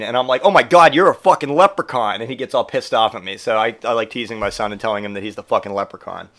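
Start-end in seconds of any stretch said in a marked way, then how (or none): 0.4-2.2 loud, higher fake revelation; mock astonished outburst. Dad teasing his son, loud mock astonishment. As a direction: exclaim it as a teasing discovery.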